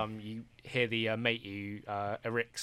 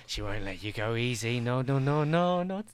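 a crazed hermit rambling menace at a stranger; unhinged druid Erixa; 3 dB louder than usual. Acted. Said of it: "He's like".

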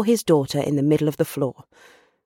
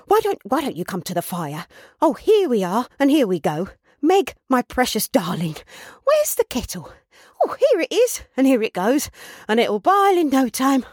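excited host fussing about, pitch leaping higher; flustered, overjoyed Trotty; 7 semitones higher than usual. Acted. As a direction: bustle breathlessly from guest to kettle.

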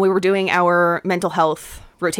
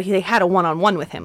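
ordinary delivery

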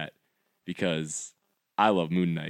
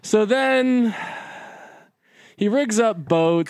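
high, slow mocking take on a friend's dull summary; mock bored recap; 11 semitones higher, 3 dB louder, slower than usual. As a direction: parody him droning on about the plot. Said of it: "An hour of you being like".

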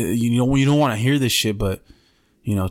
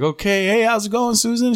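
re-enacted hello, pitched way up; high-pitched greeting; 10 semitones higher than usual. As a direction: greet her in a put-on high voice. Greeting Susan in the story.

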